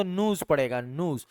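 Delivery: न्यूज़ as "nooz", no y glide; American news. Shown as a demonstration. Teacher demonstrating American न्यूज़, said "nooz". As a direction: drop the y in न्यूज़. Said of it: "जब American पढ़ेगा".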